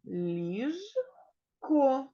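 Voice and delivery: ліжко split into ліж, pause, ко; slow teacher's sounding-out of ліжко; syllable by syllable. Shown as a demonstration. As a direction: break «ліжко» into two separate syllables. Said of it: "В цьому слові два склади".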